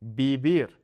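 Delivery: vivir with soft b-like bilabial sounds. Shown as a demonstration. Teacher demonstrating the correct pronunciation. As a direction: say vivir like bibir with soft lips. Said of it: "That soft B sound".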